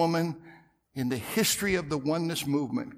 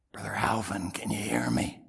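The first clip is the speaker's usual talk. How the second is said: whisper; 7 semitones lower, 6 dB quieter than usual. Retold or imitated. Whispering to man from pew behind.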